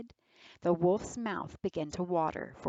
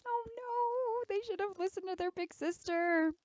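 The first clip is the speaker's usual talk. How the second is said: worried aside; 12 semitones higher, 4 dB quieter than usual. A narrator's reading. Narrator aside to audience.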